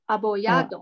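apoyado said slowly, every syllable clear; teacher models apoyado for a student. Shown as a demonstration. Slow careful model of apoyado. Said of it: "没有o".

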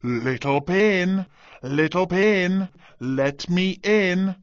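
loud, slow wolf call; wolf shouting. A narrator's reading. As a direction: call out slowly like a big wolf. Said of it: "The wolf shouted".

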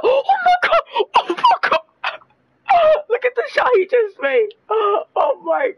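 screaming high, laughing in disbelief; shocked screaming laugh. Real, not acted. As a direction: shriek and laugh at the impossible shot. Opponent hits crazy shot.

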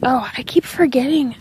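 usual speaking voice

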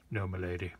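lightly voiced servant; a hint of a put-on servant's voice. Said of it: "using the same voice as the gardener".

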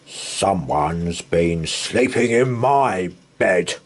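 slow grunting bear voice; grunting Father Bear. Acted. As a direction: grunt it slowly like a big bear. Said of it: "grunted Father Bear".

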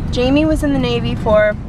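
normal talking voice

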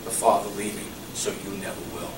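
soft, low reassuring promise; tender voice of Jesus. Retold or imitated. Preacher voicing Jesus' promise to believers, soft and low. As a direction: speak it gently like a comforting promise. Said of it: "He said".

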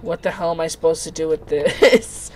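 usual speaking voice

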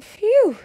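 high, loud sigh of relief; a dog's loud relieved phew. A narrator's reading. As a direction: blow it out with big relief. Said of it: "said the dog".

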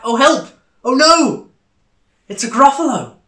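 high, loud alarmed cry; frightened cry. Acted. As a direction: shriek at the sight of the monster. Mouse sees the monster.

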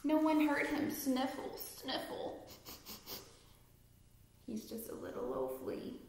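plain reading voice

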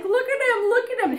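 high-pitched retold cooing at the bird; mock cooing. Storyteller coos as the bird nears the bed edge, high mock cooing.

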